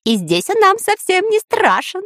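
very high-pitched gleeful voice; joyful. A narrator's reading. Dyrka rejoices at escaping the hurricane, high and joyful. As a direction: squeal it with triumphant glee. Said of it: "радовался Дырка".

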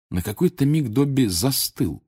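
usual speaking voice

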